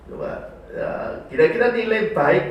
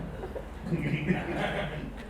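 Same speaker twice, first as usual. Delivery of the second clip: soft laugh; 10 dB quieter than usual. Real, not acted. Laughs after tender demo.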